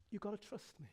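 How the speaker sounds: very soft, intimate plea; tender appeal. Acted. Preacher as God asking for trust, very soft and tender. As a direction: speak it gently, almost under the voice.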